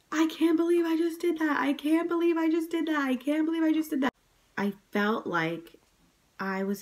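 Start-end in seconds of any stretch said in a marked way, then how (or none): 0.1-1.6 high, fast giddy disbelief; amazed disbelief. Retold or imitated. New mother after the birth, high amazed disbelief. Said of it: "I just kept saying". 1.6-4.1 high, fast giddy disbelief; amazed disbelief. Retold or imitated. New mother after the birth, high amazed disbelief. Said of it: "I just kept saying".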